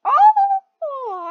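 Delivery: high, loud, drawn-out "oh"; startled howl at a near miss; alarmed cry. Real, not acted. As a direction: wail in sudden alarm. Thought other player died.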